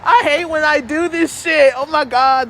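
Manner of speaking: high, loud, half laughing half crying; laughing into tears. Real, not acted. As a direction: giggle your way toward real tears. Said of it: "oh, I'm gonna cry".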